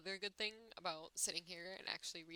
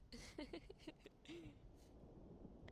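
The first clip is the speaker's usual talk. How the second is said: breathy giggle; 9 semitones higher, 11 dB quieter than usual. Real, not acted. Laugh after gushing.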